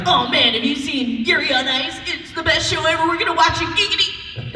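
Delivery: imitating Quagmire. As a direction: gush like Quagmire, pitch rising. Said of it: "Quagmire's like".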